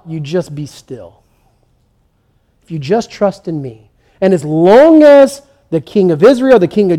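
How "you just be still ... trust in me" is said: calm them like a father would; tender reassurance. Retold or imitated. Quoting God's promise gently.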